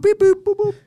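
high, loud computer beeping; robot beeps. Pretend. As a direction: beep like a calculating computer. Mock computer crunching numbers.

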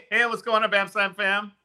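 hype podcast intro. Acted. Host's show opener again.

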